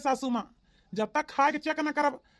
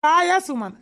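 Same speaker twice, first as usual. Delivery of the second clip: loud call for Suman's attention; 3 semitones higher, 9 dB louder than usual. Acted. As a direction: call out sharply to get Suman to listen. Father-in-law calls out to Suman.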